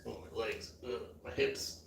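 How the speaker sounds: strained and quiet, joints aching; strained groaning. Real, not acted. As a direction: groan through sore hips. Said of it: "I gotta stand up".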